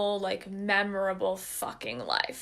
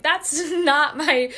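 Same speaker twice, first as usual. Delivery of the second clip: slow, louder, laughing through "not my"; laughing protest; 5 semitones higher, 6 dB louder, much slower than usual. Retold or imitated. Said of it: "And I'm like".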